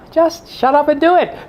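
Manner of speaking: high-pitched mock-exasperated order; exasperated comeback. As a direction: cut off the whining with a comic command. Pastor snaps back at objector.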